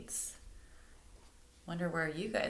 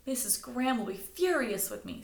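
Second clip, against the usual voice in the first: higher, mock-worried lament; mild moan; 6 semitones higher, 3 dB louder than usual. Stella moaning to the group about the librarian. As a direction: whine about the trouble ahead. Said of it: "Stella moaned".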